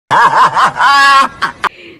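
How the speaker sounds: loud laughter. Real, not acted. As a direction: burst out laughing. Laugh burst.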